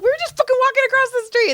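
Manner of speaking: high, fast mock outrage; indignant retold complaint. Acts out friend's indignant complaint.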